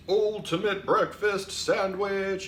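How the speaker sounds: slow, high booming announcement. Shown as a demonstration. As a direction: boom it out like an alien overlord. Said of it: "you need a booming voice".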